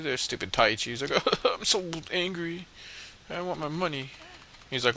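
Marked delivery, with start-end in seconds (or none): 1.4-4.1 mock sulky anger. Gamer mocking a character who wants his money, mock sulk.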